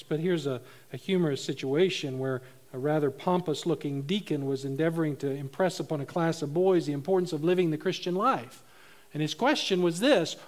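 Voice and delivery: ordinary talk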